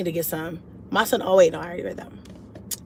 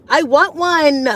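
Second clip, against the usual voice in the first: put-on higher and louder, pleading like a kid; whiny plea; 7 semitones higher, 7 dB louder, slower than usual. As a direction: beg for it with a childish wail. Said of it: "I'm like".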